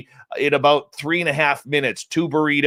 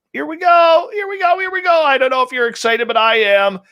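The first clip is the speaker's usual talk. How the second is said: excited shout; 10 semitones higher, 4 dB louder than usual. Real, not acted. Host bringing in the panel, excited shout. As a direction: whoop up the audience, high and rushing. I don't know if you're excited, but I am.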